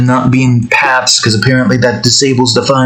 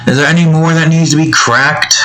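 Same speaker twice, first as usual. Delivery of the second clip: ordinary talk, nothing marked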